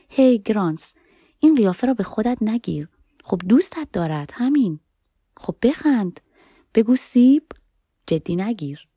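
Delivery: teasing a friend to make her smile. Acted. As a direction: rib her playfully, like friends poking fun.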